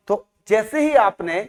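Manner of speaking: normal talking voice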